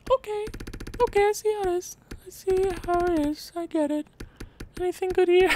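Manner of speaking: cartoonish high-pitched sarcasm; voice shooting up. Real, not acted. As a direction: let the pitch jump way up in disbelief. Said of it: "My voice just goes up seven octaves".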